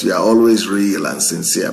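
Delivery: ordinary delivery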